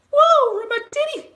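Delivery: a silly, hammed-up falsetto whoop; mock excited squeal. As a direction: squeal it way up high and loud, joking. Joking squeal after viewer praise.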